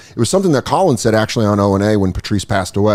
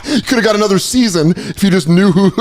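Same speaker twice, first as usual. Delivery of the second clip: laughing; 8 semitones higher, faster than usual. Real, not acted. Laughing at Colin schmoozing.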